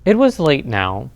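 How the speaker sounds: plain reading voice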